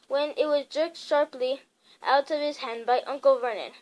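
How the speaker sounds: usual speaking voice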